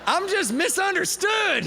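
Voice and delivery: high, slow, whiny mock self-pity. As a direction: play the poor misunderstood guy. Jokes about being rejected.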